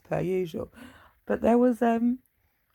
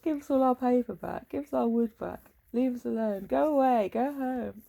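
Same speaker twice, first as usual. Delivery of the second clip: mock angry shouting; 7 semitones higher than usual. Acted quarrel, shouting rival tribe.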